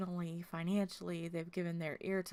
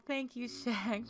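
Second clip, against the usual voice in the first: touched thanks; 4 semitones higher, 4 dB louder, slower than usual. Real, not acted. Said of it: "I'm gonna start crying".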